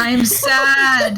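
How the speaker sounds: put-on loud blurted childish wail; hammed-up tearful outburst with a laugh; mock yell. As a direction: yell it out like a sulky kid. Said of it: "Just yells that".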